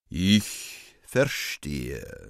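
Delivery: very slow, sonorous stage voice; hammy stage actor, deep and drawn out; theatrical bass. Acted. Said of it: "in sonorem Bass, etwas theatralisch".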